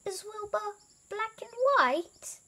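high-pitched elephant question; inquiring elephant. A narrator's reading. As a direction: ask in a high little voice. Elephant asks Elmer in story.

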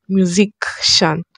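musician slowly split as "myu-zik... shan"; musician with k sound. Shown as a demonstration. Creator tests the word slowly, musician as "myu-zik-shan". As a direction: draw out musician, with a hard k before "shan". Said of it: "Is it musician or musician?".

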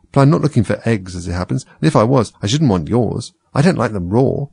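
ordinary delivery, nothing marked

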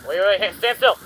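loud, high urgent cry; panicked shout. Real, not acted. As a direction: shout in alarm. Monster enters room.

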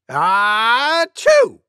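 high, loud "ah-choo" burst; acted sneeze. Cuckoo guessing how loud an elephant sneezes, acted sneeze. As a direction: build up and let out a pretend sneeze. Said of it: "Maybe as loud as this".

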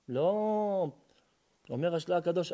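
normal talking voice

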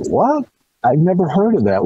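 a high, startled what, then stays up; the old lady's shock at a breed she has never heard of. Retold or imitated. Old lady recalls her shock.

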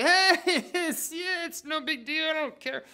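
playing it cool with a nervous laugh; nervous fake cool. Disciples pretend calm, nervous laugh. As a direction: laugh it off, pretending you're not scared.

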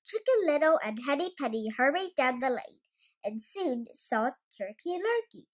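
ordinary delivery

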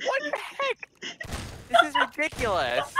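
shrill disbelief; high exasperated squeal. Real, not acted.

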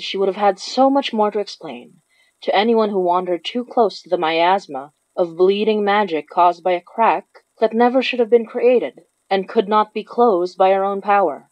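usual speaking voice

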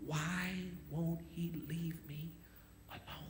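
slow, drawn-out hush; retold scream, softened. The preacher retells the man's cry to the church, slow and hushed. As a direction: let it stretch out slowly, haunted.

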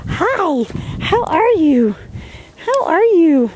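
high, drawn-out greeting to a dog; gushing pet talk. Real, not acted. Owner greeting the dog after praise, gushing. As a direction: coo it high and delighted.

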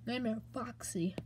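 hushed whisper of dread. Acted. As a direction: whisper it like the monster could hear. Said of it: "Why are you saying it like that?".

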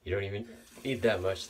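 ordinary talk

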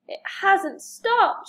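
mildly loud storybook shout; alarmed shout. A narrator's reading. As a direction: announce the trouble in alarm. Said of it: "shouted Harold".

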